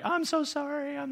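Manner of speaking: very high-pitched imitated crying voice; mock tearful apology. Couple making up after the sandwich fight, mock tearful. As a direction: apologize in a squeaky pretend sob. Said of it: "we're both crying".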